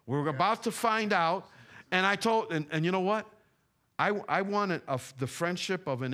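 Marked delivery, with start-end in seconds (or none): 0.1-1.5 raised, forceful preacher's warning; emphatic warning. Real, not acted. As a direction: hammer the warning home, higher. Repeats warning with more force.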